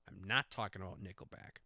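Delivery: ordinary talk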